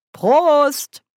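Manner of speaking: high, loud toast; Trinkspruch. A narrator's reading. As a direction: call out a cheery toast. Nanny hebt das Glas. (lauter, hoher Trinkspruch)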